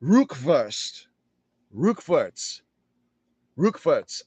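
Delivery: Rückwärts repeated with a German R and a "verts" ending; careful German Rückwärts; German word Rückwärts. Shown as a demonstration. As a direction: drill Rückwärts slowly, German style. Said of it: "but in German, it's".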